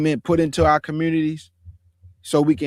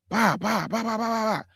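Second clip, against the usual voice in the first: 1 mock gunfire; 4 semitones higher, 4 dB quieter than usual. Imitating a burst of gunfire.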